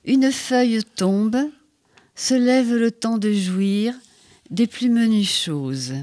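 slow, hushed haiku reading with long pauses; poem reading. Acted. Poet reading her autumn haiku to the host, slow and hushed. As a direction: let each line settle, slowly.

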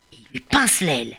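plain reading voice

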